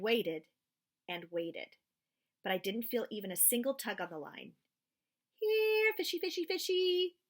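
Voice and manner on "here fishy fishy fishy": high sing-song call to the fish; boy calling fish as if they were dogs. Acted. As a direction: coax the fish like a pet. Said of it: "I called".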